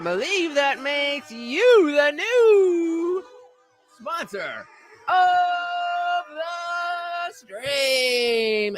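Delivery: very slow, stretched hype announcement, rising high; drawn-out announcer. Pretend. Streamer crowning a new sponsor, drawn-out announcer call. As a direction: hold the vowels like a ring announcer.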